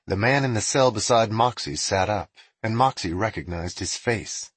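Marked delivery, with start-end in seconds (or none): none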